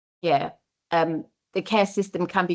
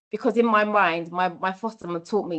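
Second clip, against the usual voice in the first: ordinary talk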